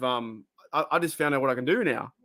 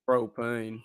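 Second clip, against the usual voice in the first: mock Hank Hill propane; 4 semitones lower, 4 dB quieter than usual. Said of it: "Hank Hill quotes".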